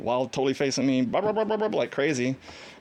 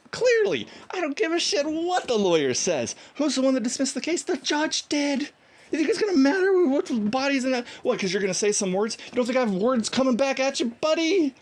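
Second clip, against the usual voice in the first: heated retort; 12 semitones higher than usual. Retold or imitated. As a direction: fire the comebacks at the cop like you are back in that argument. Retelling a heated argument with a cop.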